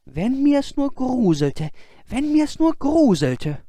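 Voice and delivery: put-on high, slow wistful boy; talking to himself. Boy on the high road repeating his wish to himself, high and wistful. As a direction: wish longingly to learn to shudder. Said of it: "sprach immer vor sich hin".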